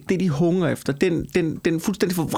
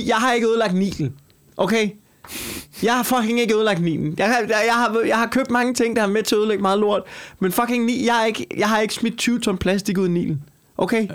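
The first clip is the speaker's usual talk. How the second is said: raised, higher protesting voice; indignant; 6 semitones higher than usual. Real, not acted. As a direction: push back hard, clearly offended. Defends himself, rising indignation.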